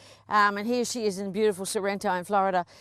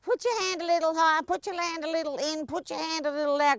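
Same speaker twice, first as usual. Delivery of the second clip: nagging instructor; 5 semitones higher than usual. Retold or imitated. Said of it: "Not me sitting in the corner going".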